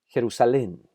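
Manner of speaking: Jerusalén with final "lén" stressed; stress on accented syllable. Shown as a demonstration. Teacher showing words with tilde, Jerusalén stressed on "lén". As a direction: punch the last syllable of Jerusalén. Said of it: "sílaba que necesita ser enfatizada".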